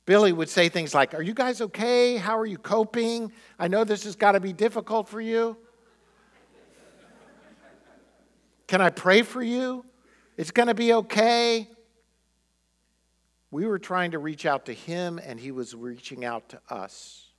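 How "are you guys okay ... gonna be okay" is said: mild caring reassurance. Retold or imitated. Condemned Billy consoling his lawyers.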